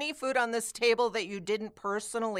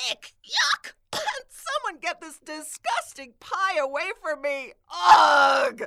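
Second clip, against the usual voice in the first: hammed-up disgust; 7 semitones higher, 7 dB louder than usual. Disgusted at the pie.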